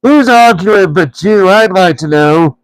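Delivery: high, loud troll challenge; troll Tom squaring up to William; indignant troll. A narrator's reading. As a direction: demand an answer, offended. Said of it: "who thought it was William's voice".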